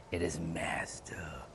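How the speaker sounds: slow breathy whisper of the word. Shown as a demonstration. Streamer whispering the pronunciation to chat. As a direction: whisper it like a secret. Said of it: "I'm gonna even put some emphasis on that".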